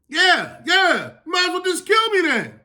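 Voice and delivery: high, shouted self-pitying outburst; sulking Jonah. Acted. Jonah sulking back at God over the lost shade, high shouted whine.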